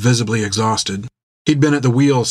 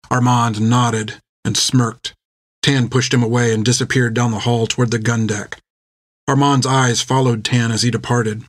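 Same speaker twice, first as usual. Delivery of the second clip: ordinary delivery, nothing marked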